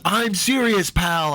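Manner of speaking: high mock-stern warning; retold angry insistence. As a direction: imitate an angry guy insisting.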